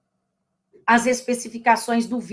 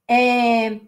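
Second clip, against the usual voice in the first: ordinary delivery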